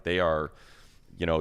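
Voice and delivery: ordinary talk, nothing marked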